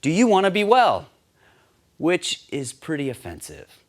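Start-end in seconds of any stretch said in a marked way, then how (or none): 0.0-1.1 loud, high, blunt question; direct. Acted. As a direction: confront him head on. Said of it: "Jesus is direct with this man".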